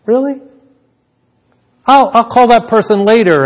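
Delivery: mildly acted "do I have to" protest; reluctant disbelief. Acting out own reluctance to God.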